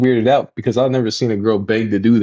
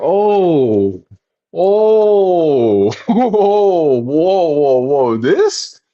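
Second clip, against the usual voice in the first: mock startled whoa; 7 semitones higher, 5 dB louder, much slower than usual. Retold shock at face.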